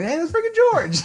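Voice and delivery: high, excited exclamation; excited recognition. Retold or imitated. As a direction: shout as if spotting a celebrity. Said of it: "and, like".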